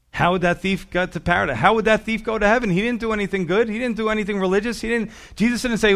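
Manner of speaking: high, loud rhetorical questioning; acted disbelief, pitched well up; incredulous challenge. Preacher voices an incredulous challenge.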